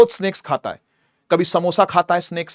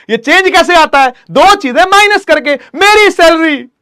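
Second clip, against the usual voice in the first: louder and higher, driving the point home; emphatic teaching; 9 semitones higher, 8 dB louder than usual. Real, not acted. Teacher emphasizing to students, loud and emphatic. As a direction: hammer the question to the class.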